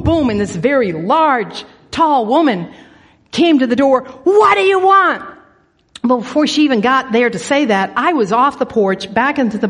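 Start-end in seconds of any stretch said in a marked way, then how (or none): 4.2-5.2 loud, high demanding bellow; acted angry shout at a doorstep caller; gruff demand. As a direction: boom it out as a challenge. Large woman comes booming to door.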